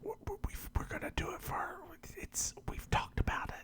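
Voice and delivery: hushed, voiceless aside; real whisper. Real, not acted. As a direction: whisper it off to the side, away from the listeners. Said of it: "Should I whisper to them still?".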